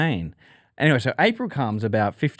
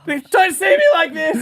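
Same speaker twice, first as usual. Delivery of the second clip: mock mortified wail; 4 dB louder than usual. Drugged, ashamed in public.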